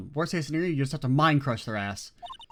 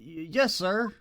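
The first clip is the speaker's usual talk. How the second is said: normal talking voice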